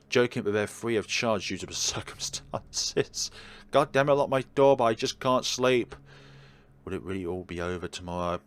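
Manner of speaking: ordinary talk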